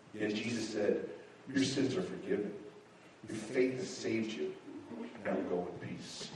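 soft, low, reverent storytelling; quiet, solemn Bible story; hushed preaching. Acted. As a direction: tell it tenderly, well below full voice.

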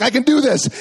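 rally yourself out loud; loud determined self-talk. Retold or imitated. Man stuck in window while wife dials for help, loud determined cry.

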